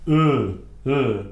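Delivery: "E" as a held "uh" with rounded lips; loud, isolated French "E"; French E sound. Shown as a demonstration. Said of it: "E sounds in the French language".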